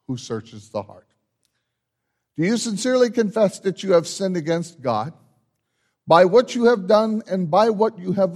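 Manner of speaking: measured, solemn liturgical voice, lifted and carrying; solemn liturgy. Acted. Pastor leading the congregation through confession, solemn liturgical voice. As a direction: lead the confession like a pastor at the altar.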